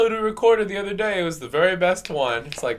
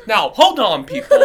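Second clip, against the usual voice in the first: teacher taking charge; 5 semitones higher, 8 dB louder than usual. Retold or imitated. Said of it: "she goes".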